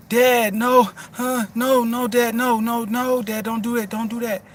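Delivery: imitating a small son's high, loud pleading yell; mock child crying out to his dad to stop. Son begs dad to stop, imitated high loud child yell. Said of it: "he yells in the".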